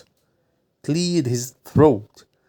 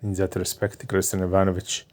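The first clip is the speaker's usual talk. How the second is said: softer, lower, shy reply; quiet; 3 semitones lower, 7 dB quieter than usual. A narrator's reading. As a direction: murmur it with an odd smile. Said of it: "he added with a peculiar smile".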